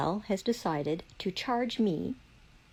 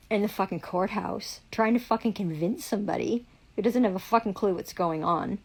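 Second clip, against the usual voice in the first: ordinary delivery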